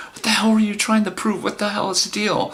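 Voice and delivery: high, re-enacted exasperation; exasperated outburst. Man confronting date in car, high exasperated outburst. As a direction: push it up high, demanding an explanation. Acted quarrel with his date.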